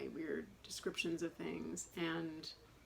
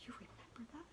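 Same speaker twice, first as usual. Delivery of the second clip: higher, hushed astonished whisper; whispered disbelief; 5 semitones higher, 13 dB quieter than usual. Retold or imitated. Imitating the doctor amazed she remembered: whisper. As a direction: whisper it like you can't believe it. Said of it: "he looked at me like".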